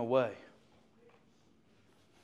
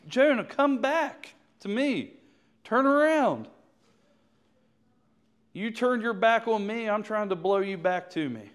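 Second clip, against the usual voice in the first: God pleading; 7 semitones higher, 4 dB louder than usual. Acted. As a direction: plead with him to come home. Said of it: "This is what God is saying".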